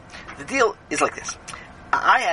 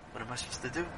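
very soft, low reply; subdued helplessness; 6 semitones lower, 15 dB quieter, much faster than usual. Acted. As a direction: drop to a low, quiet murmur. Answer to wailing Sammy.

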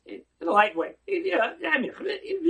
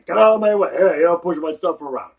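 loud, pushy yelling; rough shouting; 12 dB louder, faster than usual. Retold or imitated. Student shoving a classmate aside, loud and angry. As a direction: bark at him to get out of the way.

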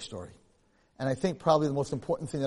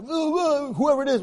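mock class hooting; 11 semitones higher, 5 dB louder than usual. Class reacting to threat.

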